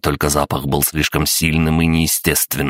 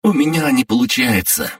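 put-on panicked girl; 9 semitones higher than usual. A narrator's reading. Remmy panicking.

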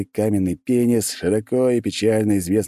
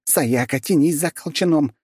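high, urgent orders; urgent commands; 10 semitones higher than usual. Acted. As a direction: hurry them along. Tami urgently giving orders.

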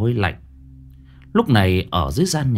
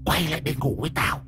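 put-on menacing ghostly old woman; 10 semitones higher, 6 dB quieter, faster than usual. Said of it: "tiếng của bà Sim vọng ra".

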